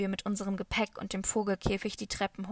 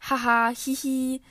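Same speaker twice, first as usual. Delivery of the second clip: louder, higher witch cackle; shrill witch laugh; 4 semitones higher, 7 dB louder than usual. A narrator's reading. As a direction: cackle like a gingerbread-house witch. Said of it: "rief Maria grell lachend, wie eine Knusperhexe". (grelles Hexenlachen)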